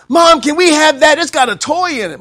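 put-on high, loud whiny kid begging mom; child asking mom for cereal, high and pleading.

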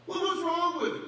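high, loud, pushy question; challenging coworker. Retold or imitated. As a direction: needle him about it. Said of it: "coworker challenging".

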